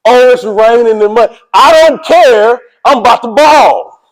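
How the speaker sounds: high, loud, drawn-out declaration; defiant shout. Acted. Coach acts out a player who doesn't care about rain, defiant shout. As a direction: build to a big, stretched-out hype yell. Acted defiance of bad weather, building.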